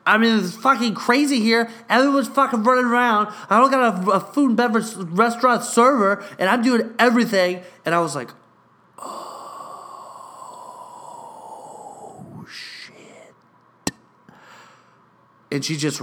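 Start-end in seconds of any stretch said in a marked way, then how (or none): 0.0-7.6 higher, louder, frantic imitation of a frazzled coworker; hammed-up frantic drunk coworker. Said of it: "she turned around, she was like". 9.0-13.3 whispered oh shit to himself; shocked private whisper; whispered aside. Retold or imitated. Reacting privately to drunk coworker.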